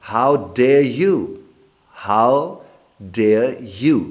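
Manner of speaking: loud, slow, outraged How dare you; indignant demand. Shown as a demonstration. Teacher shows the confrontation, slow and loud. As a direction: demand it like you are insulted. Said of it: "तो वो जुमला क्या बोलेंगे?".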